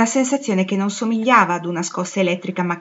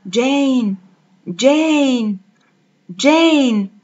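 a name called from far away, rising louder; haunting far-off call, louder each time; distant calling voice; 5 semitones higher, 5 dB louder, much slower than usual. A narrator's reading. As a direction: call out Jane across a great distance. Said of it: "una voce che chiamava da lontano".

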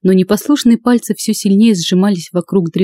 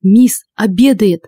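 plain reading voice

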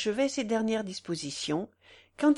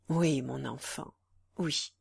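low, gentle assent; tender reassurance; 6 semitones lower than usual. A narrator's reading. Man assuring her of their love, tenderly. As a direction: soothe her with a lowered voice.

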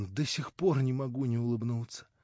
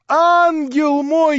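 higher, louder, slowly stretched endearment; drawn-out rapturous cry; 14 semitones higher, 13 dB louder, much slower than usual. A narrator's reading. Young man repeating endearment to Natasha, stretched ecstatic. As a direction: linger on ангел мой in ecstasy. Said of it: "кричал он".

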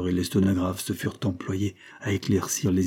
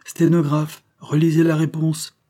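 raised, louder command; ordering; 8 semitones higher, 5 dB louder than usual. A narrator's reading. Substitute ordering the stenographer to reread. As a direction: snap out the order to the clerk. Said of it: "ordonna-t-il enfin".

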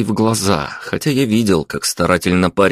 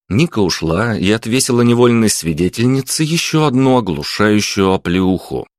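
plain reading voice

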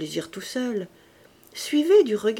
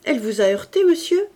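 loud, rising solicitous question; fussing hostess; 5 semitones higher, 7 dB louder, faster than usual. A narrator's reading. Hostess apologising to the guest, loud rising question. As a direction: fuss over the guest. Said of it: "dit madame Josserand".

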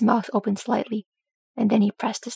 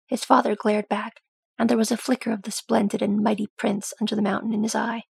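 plain reading voice

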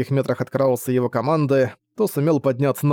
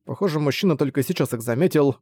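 ordinary delivery, nothing marked

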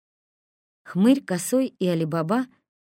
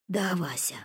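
moaning; 6 dB quieter than usual. A narrator's reading. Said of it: "простонал Хмырь".

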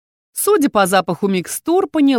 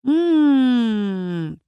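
drawn-out hum; 4 semitones higher than usual. Acted. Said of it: "протянул Апс".

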